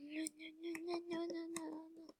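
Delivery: very soft, high repeated chant; tiny fretting voice. Real, not acted. Player fumbling the screenshot, tiny high fretting. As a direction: repeat it tiny and high under your breath.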